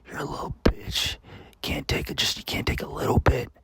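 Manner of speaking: whispered jeering right into the ear; Roger's taunting whisper. Retold or imitated. Classmate behind him at graduation talking trash in his ear, whispered. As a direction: imitate a sinister whisper over someone's shoulder. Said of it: "talking shit on me".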